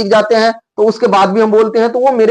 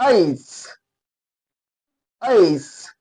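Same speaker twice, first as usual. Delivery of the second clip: ऐस stressed on the vowel, trailing into a hiss; stressed letter H; 3 semitones lower, 5 dB quieter, much slower than usual. Shown as a demonstration. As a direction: lean on ऐस, then let the final sound hiss out. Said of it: "इसको stress कहते हैं".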